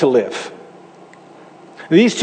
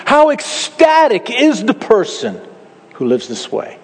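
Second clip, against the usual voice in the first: emphatic joy; 4 semitones higher than usual. Shown as a demonstration. Preacher showing the Hebrew's true emphasis, slow and exultant. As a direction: stretch out each word in wonder. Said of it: "the way it's written in the Hebrew is".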